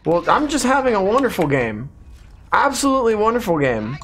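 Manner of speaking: mock praise of a bad game; sarcastic. Real, not acted. Losing badly, praising the game.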